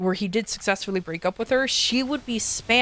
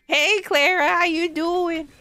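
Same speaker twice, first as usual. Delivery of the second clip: higher, slow caricature of own greeting; mock version of own voice; 9 semitones higher, slower than usual. The speaker repeats her self-caricature greeting to Clara, slower and high. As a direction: stretch it slow and high, mocking yourself. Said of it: "my own interpretation of my voice".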